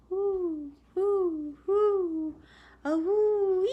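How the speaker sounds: high, rising, ghostly wail; eerie wailing whoo. Acted. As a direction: howl like a spooky night creature. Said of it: "came the terrible noise".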